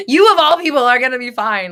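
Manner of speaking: high, loud insistence; emphatic loud reassurance. Real, not acted. Streamer loudly assures Valerie she'll be fine. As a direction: drive it home that she'll be fine.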